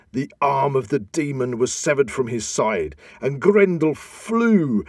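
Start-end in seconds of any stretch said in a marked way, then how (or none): 3.2-4.8 higher, louder and slow, a ringing peak; dramatic climax. A narrator's reading. Narrator crying out as Grendel flees, high and slow. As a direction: lift it like the story's turning point.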